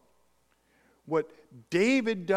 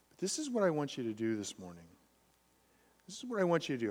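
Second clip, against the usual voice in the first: ordinary talk, nothing marked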